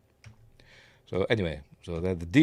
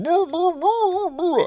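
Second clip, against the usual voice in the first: mock muffled cries; 4 dB louder, slower than usual. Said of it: "But he's like going".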